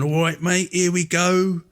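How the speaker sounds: chirpy London greeting, lightly hammed; imitating a Cockney presenter; Cockney accent. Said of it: "liked that kind of accent".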